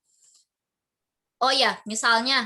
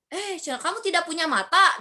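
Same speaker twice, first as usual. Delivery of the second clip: a sharp, raised scolding of a classmate; acted angry rebuke; 4 semitones higher than usual. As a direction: snap at him, but only as a classroom example. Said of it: "Ojan misalnya marah-marah ke Iman". (Ojan memarahi Iman, nada marah pura-pura)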